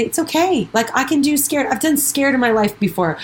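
upbeat pep talk to yourself; encouraging self-talk. Acted. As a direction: talk your scared brain down kindly. Answering the fearful brain.

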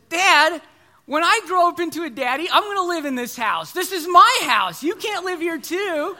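put-on high, loud, cheeky kid voice; bossy little boy. Son laughing at dad, claiming the house high and loud.

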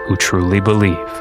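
ordinary delivery

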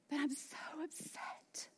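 voicing the listener, whispered; hushed mock protest; whispered complaint. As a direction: whisper the objection softly.

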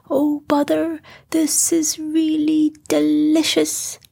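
a bear lost in honey bliss; Pooh voice. Acted.

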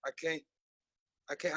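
ordinary talk

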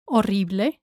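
Horrible as o-RREE-bleh; Spanish double R in Horrible. Shown as a demonstration. Teacher models for learners, Horrible with double R. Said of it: "pronounce the double R".

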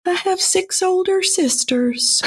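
slow, very high, small voice; client Bill's voice. Retold or imitated. Client Bill admitting to older sisters, high falsetto imitation. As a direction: answer in a meek put-on falsetto. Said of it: "And he said".

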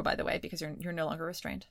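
normal talking voice

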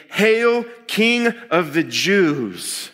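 sneering, drawn-out mock hail. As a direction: jeer it slowly like a soldier. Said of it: "They say it mockingly".

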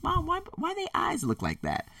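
put-on very high, soft little-kid voice; curious child. Boy asking mom about people on TV, imitated child voice.

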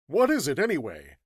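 loud, high burst falling away; curious. Acted. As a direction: blurt the question out with curiosity. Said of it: "asked Hatch curiously".

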